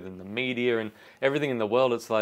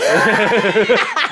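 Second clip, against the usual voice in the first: loud laugh; 9 semitones higher, 10 dB louder than usual. Real, not acted. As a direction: burst out in a high loud laugh. Big laugh at joke.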